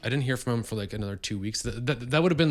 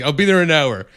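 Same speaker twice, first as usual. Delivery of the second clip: louder, higher confident promise; imitating Momoa; 6 semitones higher, 11 dB louder than usual. Jason Momoa telling the owner he's coming, loud easy mock voice.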